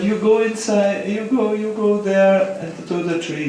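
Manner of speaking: urgent sending away. Retold or imitated. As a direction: shoo them off towards the tree. Old man sends the sailors away.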